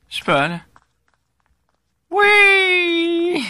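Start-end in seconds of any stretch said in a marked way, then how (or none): 2.1-3.4 high, loud victory cry; triumphant shout. Real, not acted. Player scoring a kill shouting in triumph, victory cry. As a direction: yell out the win.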